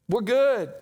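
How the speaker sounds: high, loud mock refusal; crowd declining. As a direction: imitate a crowd hastily backing out.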